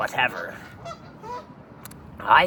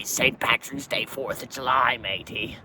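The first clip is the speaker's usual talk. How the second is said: usual speaking voice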